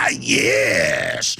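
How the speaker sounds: low, drawn-out gloating villain; hammed-up villain voice, pitched low; mock villain. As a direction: relish it like an evil mastermind. Gloating villain after quoted threat.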